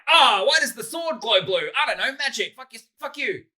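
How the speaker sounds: high, hammed-up indignant outburst; mock exasperated argument. Explaining fantasy's appeal to a co-host, mock exasperation.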